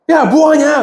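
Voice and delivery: sharp call-out. Retold or imitated. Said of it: "그러니까".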